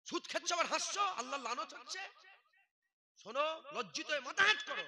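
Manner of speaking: loud, high reproach of the laughing crowd; angry scolding. Real, not acted. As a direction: shame them out loud.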